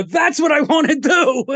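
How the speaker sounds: high-pitched, thrilled declaration; retold childlike excitement. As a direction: act out a kid's gleeful revelation.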